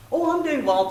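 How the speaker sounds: put-on high breezy little-girl doctor; child doctor. Daughter playing doctor greeting her patient, high. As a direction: play the little doctor brightly.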